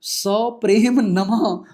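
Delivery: slow, loud, stretched सप्रेम नमः; hammed-up affectionate greeting; drawn-out greeting. Shown as a demonstration. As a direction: draw out सप्रेम नमः lovingly and slowly. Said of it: "मर जाएगा वो".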